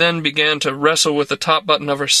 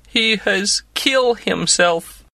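mournful; 5 semitones higher, slower than usual. A narrator's reading. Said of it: "she said mournfully".

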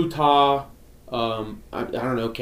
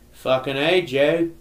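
put-on Aussie accent, building louder and higher; Australian maintenance boss; 3 semitones higher, 4 dB louder than usual. Australian maintenance man talking to boss, Aussie accent. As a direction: do the Australian plant guy, rising to a complaint. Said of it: "this guy was Australian".

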